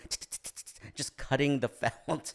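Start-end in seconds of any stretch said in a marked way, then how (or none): 0.1-0.9 quiet voiceless rapid ticking; imitated scissor snips. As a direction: make little snipping noises with the tongue. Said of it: "cutting in between scenes, going".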